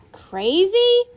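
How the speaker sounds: loud high screech of the word; screamed. A narrator's reading. Round woman on the roof screaming down at the boat. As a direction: scream it back in outrage. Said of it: "the round woman screamed".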